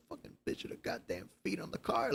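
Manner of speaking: whispered grumbling to himself; imitated mumbling. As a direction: mutter it furiously under your breath, voiceless. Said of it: "hear him mumbling to himself".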